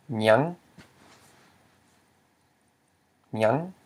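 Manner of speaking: nyang with a rising tone. Shown as a demonstration. Teacher models the word for what, nyang rising. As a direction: let nyang glide upward. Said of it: "So it's pronounced as".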